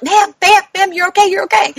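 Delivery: high, urgent calling out; urgent calming staff. Retold or imitated. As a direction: try to get through to a flailing patient. Staff calming a combative patient.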